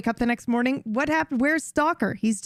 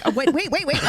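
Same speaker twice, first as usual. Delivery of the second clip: higher, stunned wait-wait-what through a laugh; laughing disbelief; 7 semitones higher, 3 dB louder, much faster than usual. Retold or imitated. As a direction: catch yourself in shocked amusement. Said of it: "I'm just like".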